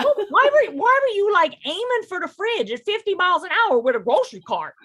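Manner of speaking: loud, high, fed-up rant; exasperated. Real, not acted. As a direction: blurt it out in disbelief. Ranting about fridge rammers.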